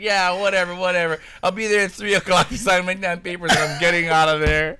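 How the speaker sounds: high, fed-up brush-off; dismissive customer. Retold or imitated. Annoyed customer agrees to sign, high and curt. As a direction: wave him off and stay annoyed. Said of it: "She's like".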